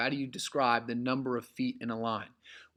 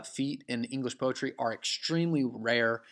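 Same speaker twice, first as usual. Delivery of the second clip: normal talking voice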